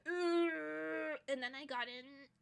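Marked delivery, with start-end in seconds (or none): 0.1-1.2 loud, groaning ugh of frustration; exasperated ugh. Retold or imitated. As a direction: let out a fed-up ugh. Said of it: "And I was like".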